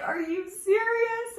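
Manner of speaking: high, slow, stunned; can't believe the kindness; amazed disbelief. Retold or imitated. Boss offers advance.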